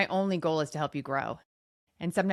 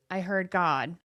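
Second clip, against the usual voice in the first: ordinary delivery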